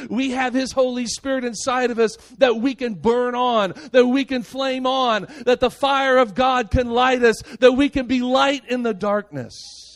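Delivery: high sermon voice, building; impassioned preaching. Acted. Preacher rallying believers to burn with God's fire, impassioned. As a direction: build phrase on phrase with rising force.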